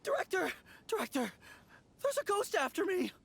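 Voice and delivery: high, frantic cry for help; high-pitched terrified alarm; panicked calling out. Acted. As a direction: call for the boss as if chased by a ghost. Flees to boss from supposed ghost.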